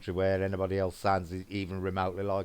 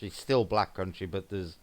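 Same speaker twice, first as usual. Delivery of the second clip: ordinary delivery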